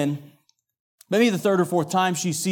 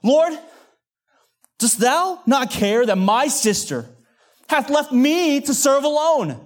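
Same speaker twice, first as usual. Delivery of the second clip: high, loud, drawn-out complaint; indignant yell; 9 semitones higher, 4 dB louder, slower than usual. Acted. Martha interrupting Jesus's teaching, indignant outcry. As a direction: protest it loudly, stretching the words. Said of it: "When Martha yells".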